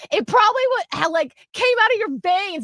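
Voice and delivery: put-on high-pitched kid arguing; imitating shouting girl. Girl teasing about a red slushie, mock shouting. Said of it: "like, shouting this".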